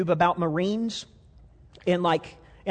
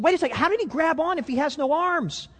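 much higher, baffled mock double take; puzzled buddy; 9 semitones higher than usual. Buddies realising he has no arms, higher baffled surprise. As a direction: voice the sudden realisation, wide-eyed. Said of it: "looked over at each other and said".

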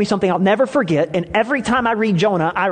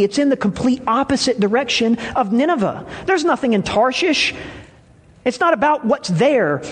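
preaching; 4 semitones higher than usual. Acted. Pastor preaching on Jonah.

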